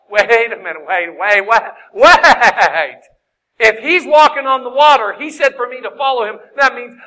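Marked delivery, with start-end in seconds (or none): 0.1-3.0 louder, eager wait wait wait; catching on to an idea mid-storm; excited realisation. Retold or imitated. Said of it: "he's like".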